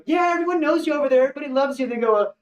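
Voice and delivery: high, fond voice of friends; lightly mocking, fond praise; mock gushing friends. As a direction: gush about him like old fans. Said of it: "they'd be like".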